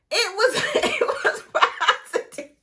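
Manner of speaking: high laughing reveal, words half lost in the laugh; laughing through it. Real, not acted. To her viewers about the positive test, laughing. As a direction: giggle out the news, barely holding it together.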